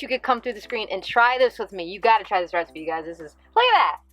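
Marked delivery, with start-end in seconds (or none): 3.5-4.0 excited. Real, not acted. Showing off the food.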